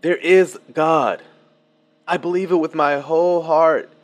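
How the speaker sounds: mirror her conviction back as a demo; mock counter-claim. Shown as a demonstration. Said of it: "What if I use the counter argument?".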